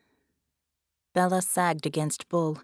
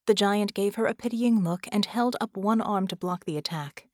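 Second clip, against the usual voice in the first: plain reading voice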